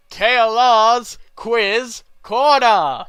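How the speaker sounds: slow, booming game show title with echo; echo effect. Pretend. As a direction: announce it big through an echo. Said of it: "I'm just gonna put in an echo effect here".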